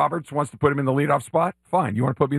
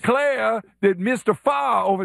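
higher-pitched mock Southern belle accent; fake Southern accent; 5 semitones higher than usual. Host mocking people who show up with accents, fake Southern. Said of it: "just show up with an accent".

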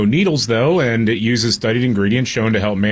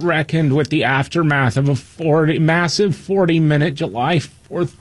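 higher, mildly whiny reading; a hint of a whiny liberal; 3 semitones higher than usual. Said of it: "in my whiny liberal voice".

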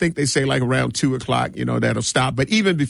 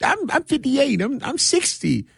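high mock pleading voice; mock older attendee; 10 semitones higher than usual. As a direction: imitate someone arguing their age. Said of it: "you be like".

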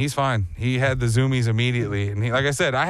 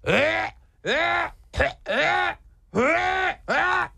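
high strained dog choking gasps; hammed-up choking yelps, much higher; imitated choking dog; 14 semitones higher, much slower than usual. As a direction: gag out high like a dog with a stick in its throat. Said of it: "his dog is just literally going".

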